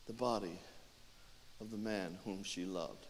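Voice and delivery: soft, low, sorrowful telling; hushed narration. A narrator's reading. Narrator as she cleans his body, hushed and low. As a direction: tell it quietly, with long pauses.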